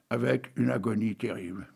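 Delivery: ordinary talk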